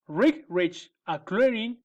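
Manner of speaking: plain reading voice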